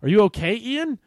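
high, loud question leaping up at the end; loud concerned call. Acted. Drew checking on returning Ian, calling out high. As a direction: call out, pitch soaring on the name.

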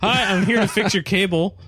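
high, loud hammy cable guy; cheesy cable guy. Pretend. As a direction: overplay the cable repairman line. Acted cable guy line.

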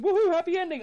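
high, loud sarcastic woohoo; a cheer he says he wouldn't give; mock cheer. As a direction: fake a gleeful cheer. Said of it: "I'm not going".

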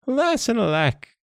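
high-pitched storybook monkey cry; lamenting monkey. A narrator's reading. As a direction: wail it lightly as a monkey, without extra volume. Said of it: "cried the monkey".

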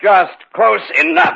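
slow high taunting closer; menacing. Acted. Killer creeping toward Stebbins, slow and taunting. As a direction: savour every word as you close in. Killer closing in on his victim.

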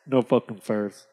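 low, unmoved refusal; deadpan low chief; Red Owl. Acted. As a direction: drop the pitch and refuse bluntly. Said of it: "The owl says".

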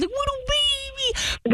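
put-on high, slow cooing baby talk. Prank caller gushing over the bear in baby talk. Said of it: "I started baby talking the bear".

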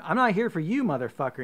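high, loud screaming threat. Retold or imitated. Screaming at the charging brother before punching him. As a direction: scream it right in the brother's face. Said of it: "so you scream".

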